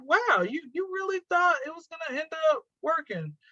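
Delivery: high-pitched mock disbelief; incredulous. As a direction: act out your amazement at their nerve. Acting out her incredulous reaction.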